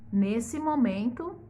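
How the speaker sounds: ordinary delivery, nothing marked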